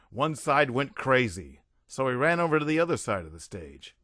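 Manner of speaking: ordinary delivery, nothing marked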